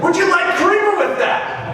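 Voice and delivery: over-the-top cheery service voice; mock waiter voice. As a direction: squeak the question like a tired barista. Coffee waiter quoted.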